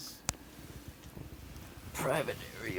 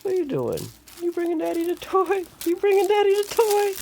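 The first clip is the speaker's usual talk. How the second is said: excited pet talk; 6 dB louder than usual. Real, not acted. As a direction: squeal with delight at the cat. Excited baby talk to cat.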